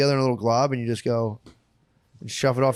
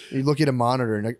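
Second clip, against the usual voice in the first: usual speaking voice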